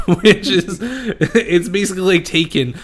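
high, loud, laughing through the words. Real, not acted. Host tells friends the silly Taken-like premise, real laughter. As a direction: crack up while explaining it. Cracking up over film's premise.

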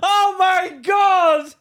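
high, drawn-out cry through laughter; hysterical exclamation. Real, not acted. Podcaster cracking up at his friend's joke, hysterical exclamation. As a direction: shout it slowly, cracking up.

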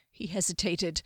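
plain reading voice

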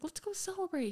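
put-on high, cheery quote of a boyfriend; upbeat imitation. Retold boyfriend, cheerful suggestion.

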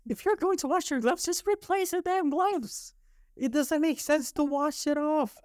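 very high-pitched, incredulous rant; exasperated. Real, not acted. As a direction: let exasperation push the voice way up. Ranting about coworkers washing gloves.

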